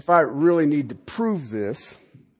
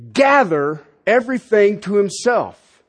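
ordinary delivery

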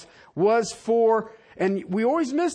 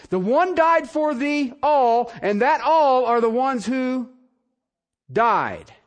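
emphatic preaching; 3 semitones higher, 3 dB louder, slower than usual. Real, not acted.

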